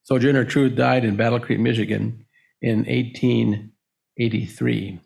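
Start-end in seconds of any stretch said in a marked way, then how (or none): none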